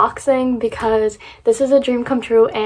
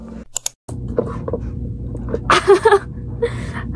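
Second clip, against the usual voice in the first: high breathy laugh; 9 semitones higher, 3 dB quieter than usual. Real, not acted. Bursts into laughter on camera.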